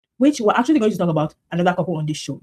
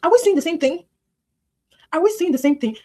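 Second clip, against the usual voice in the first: disbelief; 9 semitones higher, 3 dB louder than usual. Retold or imitated. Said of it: "And I'm like".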